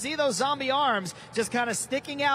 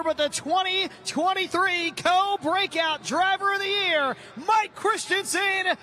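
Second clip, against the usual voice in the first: building hype intro, rising to a shout; big arena driver introduction; arena announcer; 6 semitones higher than usual. Real, not acted.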